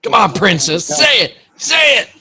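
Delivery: high, loud mocking taunt, yelled; taunting shout. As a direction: jeer at her to give up. Taunting opponent to quit.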